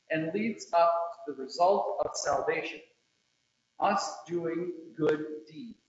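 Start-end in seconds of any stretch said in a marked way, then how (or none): none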